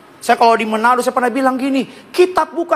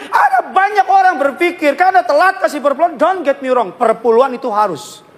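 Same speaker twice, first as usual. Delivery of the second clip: ordinary delivery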